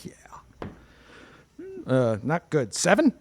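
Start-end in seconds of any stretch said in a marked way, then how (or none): 0.0-0.1 whispered. Acted. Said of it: "It's like".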